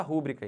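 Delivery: "rúbrica" as RÚ-bri-ca; wrong stress on RÚ. Shown as a demonstration. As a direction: stress RÚ in "rúbrica", as people misuse it. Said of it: "não pode". ("rúbrica" com força no RÚ)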